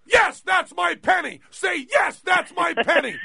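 high, loud yelling imitation of Teller; mock yell right in his face; imitated scream. Teller screaming at his patient to say the line. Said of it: "he's screaming in my face".

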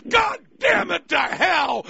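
high, drawn-out mock outburst; hammed-up exclaiming, each word stretched; astounded listener at home. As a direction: cry it out slowly in exaggerated amazement. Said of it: "People are at home going".

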